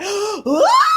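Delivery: high shocked yelp; startled shriek. Retold or imitated. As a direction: shriek in surprise. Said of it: "I was like".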